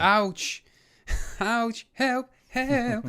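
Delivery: tiny helpless voice crying out; high squeaky cry for help. Pretend. Joking cry of pain and help.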